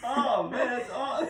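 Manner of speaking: high-pitched helpless laughing; real laughter. Real, not acted. Host delighted by guest's nose burp, cracking up. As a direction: squeeze the words out while laughing hard.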